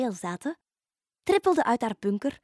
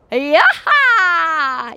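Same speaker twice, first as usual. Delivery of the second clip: higher, loud cackling cheer; gleeful shriek; 7 semitones higher, 12 dB louder than usual. A narrator's reading. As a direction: whoop with wild joy. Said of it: "gilde heks Fiona opnieuw".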